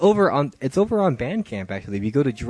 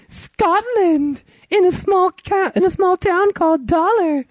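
put-on very high girlish voice, slow on the small town; girl's voice; 5 dB louder, slower than usual. Reading the girl's texts to his cohost in a very high girlish voice. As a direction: squeak it out like a teenage girl.

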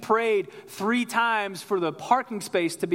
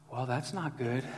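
low, soft deadpan reaction; straight-faced understatement; 5 semitones lower, 8 dB quieter than usual. Retold or imitated. Wife hearing of a huge disaster, straight-faced and quiet. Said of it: "straight-faced people".

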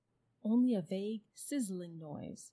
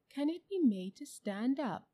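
higher-pitched, pondering question; thoughtful elephant; 6 semitones higher, 5 dB louder than usual. A narrator's reading. Elephant asks animals about Uncle Andrew, thoughtfully. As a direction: wonder it aloud, a touch louder. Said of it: "said the elephant thoughtfully".

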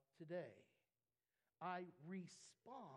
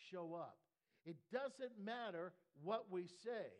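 loud, rising sermon build, pressing each example; preaching at full force; 4 semitones higher, 6 dB louder than usual. Acted. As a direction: preach it hard to the congregation.

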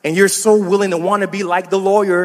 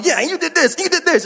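higher, mock whiny accusation; mock accuser; 8 semitones higher than usual. Pastor mimicking someone stomping on an apology, whiny. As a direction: pile on the blame like a sulking child. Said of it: "they just start stomping their foot on you".

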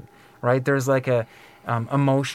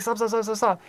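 urgent stop; 8 semitones higher than usual. Retold or imitated. Woman stops Da Vinci.

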